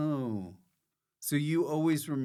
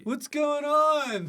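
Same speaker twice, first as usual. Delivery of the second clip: high, slow, drawn-out punter voice; mock a clueless festival-goer wandering in; 14 semitones higher, 4 dB louder, slower than usual. Co-host mocking a tent drop-in, high and drawn out.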